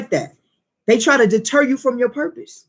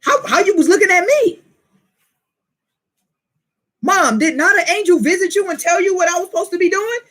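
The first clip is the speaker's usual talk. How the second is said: high, sassy back-talk; kid talking back; 8 semitones higher than usual. Retold or imitated. A child talking back to his mother, high and sassy. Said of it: "if your kid was like".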